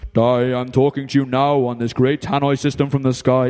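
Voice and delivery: low, loud booming mock God; tannoy voice from the sky. God mockingly imagined calling Di, booming tannoy voice. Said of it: "He didn't speak to me like".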